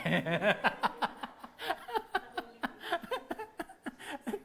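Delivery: long high chuckle fading off; amused laugh. Real, not acted. Preacher laughing at the lazy-worker joke, chuckling.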